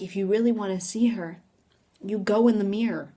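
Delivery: ordinary delivery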